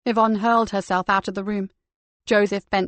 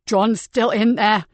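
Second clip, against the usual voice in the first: choked; 5 dB louder, slower than usual. Acted. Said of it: "he choked".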